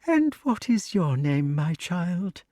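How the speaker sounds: high, gentle snake voice; kindly snake. A narrator's reading. Snake asks Wart his name, high and kindly. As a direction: ask it like an elder.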